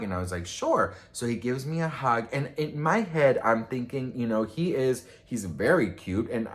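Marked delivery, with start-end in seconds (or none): 0.5-0.9 high sure. Retold or imitated. Agreeing to a hug.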